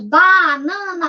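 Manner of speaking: BANANA slowly, BA, NA, NA, one beat per step; syllable by syllable. Shown as a demonstration. Teacher shows counting by steps, syllable by syllable. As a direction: draw BANANA out in three beats. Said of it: "você pode dar passos".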